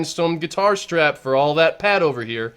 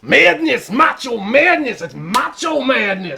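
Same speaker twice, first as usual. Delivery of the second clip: announcer hype; 7 semitones higher, 4 dB louder than usual. Acted. Wrestling announcer hype call.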